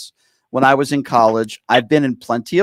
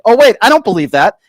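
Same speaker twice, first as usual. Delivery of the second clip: higher and louder, scoffing disbelief; mock disbelief; 7 semitones higher, 6 dB louder than usual. Radio host mocking a Trump supporter's denial, in a higher, louder scoffing voice. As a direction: play the stubborn supporter who won't believe it. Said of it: "And then they say".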